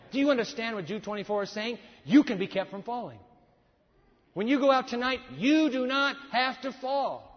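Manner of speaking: loud, high preacher's climax, punching each line; preaching at full force. Acted. Preacher urging the church, loud and rising. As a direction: drive it home to the congregation.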